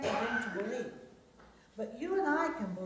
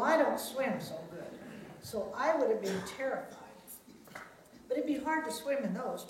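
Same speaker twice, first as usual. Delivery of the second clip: plain reading voice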